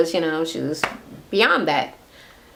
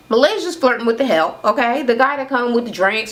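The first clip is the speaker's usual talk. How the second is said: normal talking voice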